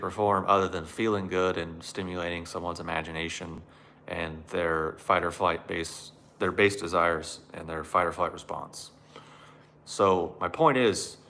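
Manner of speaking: ordinary talk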